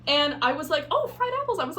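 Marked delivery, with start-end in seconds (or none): 0.9-1.6 high, delighted gasp of a realization; mock delighted. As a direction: play it bright and clueless, pitched way up. Naive excited quote of self.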